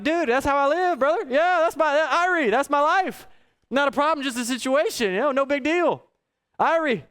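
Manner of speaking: high, bright-hearted excited reply; excited agreement. Retold or imitated. Tourist to Jamaican driver: high excited delight. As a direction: gush it like it's your whole philosophy.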